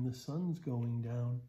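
usual speaking voice

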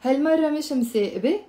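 quick, run-together هالمرة مش مسابة. Shown as a demonstration. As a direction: say هالمرة مش مسابة fast, as one phrase. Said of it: "Notice how I said it faster now".

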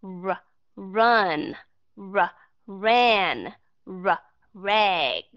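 r, run, r, ran, r, rag, each r said with lips rounded like u; English r drill. Shown as a demonstration. Teacher drilling students on the English r before each word. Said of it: "Các bạn có nghe được sự khác biệt chưa".

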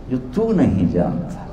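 low, hushed, guileless echo; innocent. Retold or imitated. Dervish echoing the scholar's words back, hushed and innocent. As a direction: let it drop softly and gently. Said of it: "بڑی معصومیت سے بولے".

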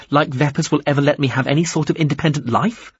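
incredulous, pushed-up voice; scornful. Acted. Captive scoffs at offer.

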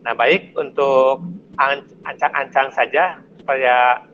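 ordinary delivery

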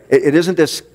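ordinary talk

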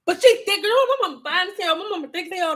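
ordinary talk

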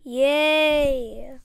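loud happy cheer. Real, not acted. Gamer cheering at the rare fruit, loud and happy.

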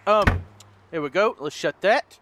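ordinary talk

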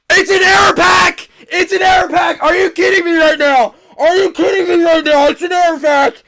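excited shouting. Real, not acted. Finding an error pack.

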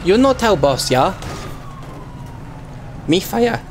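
hammed-up Chinese-style accent in broken English; mock broken-English accent. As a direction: play a delivery driver with a crude Chinese accent. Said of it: "I'm so sorry about the accent".

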